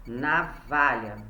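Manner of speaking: "Navalha" drawn out as na-valha, low; slow word reading. Shown as a demonstration. Teacher showing pupils a picture, "Navalha" read out slowly. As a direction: say "Navalha" slowly, low, first syllable set apart. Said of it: "Deixa eu botar aqui navalha".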